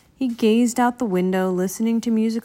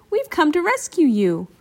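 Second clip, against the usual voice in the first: high, raised calling voice; mild high-pitched shout; 6 semitones higher, 3 dB louder than usual. A narrator's reading. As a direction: call out to someone you're rescuing. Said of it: "Bill shouted".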